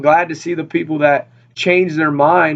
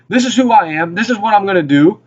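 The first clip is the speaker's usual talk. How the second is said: God speaking boldly; 6 semitones higher than usual. Acted. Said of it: "he's putting it right in his face".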